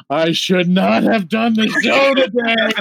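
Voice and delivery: high, slow, laughing hoarse complaint. Retold or imitated. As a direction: fake a voice that's gone, laughing. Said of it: "you think".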